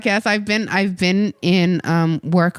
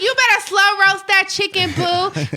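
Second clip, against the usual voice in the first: sassy playful; 11 semitones higher than usual. Acted. Playful order about the chicken.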